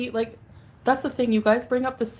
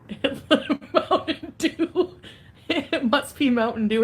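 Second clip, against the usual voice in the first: high, slow laugh through the words; 4 semitones higher, slower than usual. Real, not acted. Streamer laughing while naming her drink. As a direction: giggle it out.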